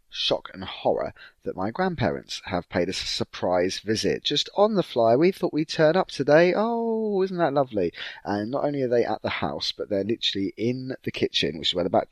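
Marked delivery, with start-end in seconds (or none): 4.2-7.9 high, sing-song mock sweetness; chirpy grandparent. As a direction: play the surprise visitor, all twee. Mocking grandparents' chirpy surprise.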